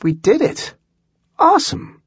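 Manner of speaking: high, thrilled burst of joy; excited. A narrator's reading. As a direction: cheer it like a win. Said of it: "Holly was actually an optimist".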